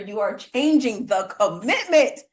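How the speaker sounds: high, excited repeating back; excited, asking the host to repeat the point; enthusiastic. Real, not acted. Host repeating the guest's point back, excited and high. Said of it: "uh, enthusiasm".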